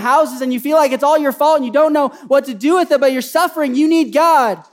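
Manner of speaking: loud, high, impassioned sermon; fervent preacher building to a cry; full-force preaching. Real, not acted. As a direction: drive it home to the congregation.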